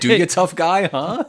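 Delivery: playful taunting challenge; mock threat. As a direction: needle them like you're picking a fight. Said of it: "negotiating from the very interesting position".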